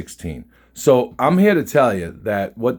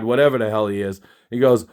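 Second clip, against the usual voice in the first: usual speaking voice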